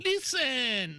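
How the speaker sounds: hammy imitation of a fake accent; fake Puerto Rican accent. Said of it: "great moments in fake accent history".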